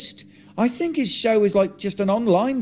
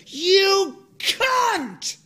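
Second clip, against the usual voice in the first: high, hurled furious insult; 13 semitones higher than usual. Retold or imitated. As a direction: spit it at the driver with full rage. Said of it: "and just said".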